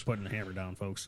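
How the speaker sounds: low, very soft pilot intercom voice; mock pilot announcement. Co-host plays the pilot on the intercom, mock announcement. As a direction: murmur it like a captain on the intercom.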